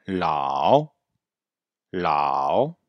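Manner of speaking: LAO drawn out, tone dipping then rising; "Lao" stretched, closing on a small o; model pronunciation. Shown as a demonstration. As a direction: say "Lao" slowly for learners to repeat. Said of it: "Donc, répétez bien".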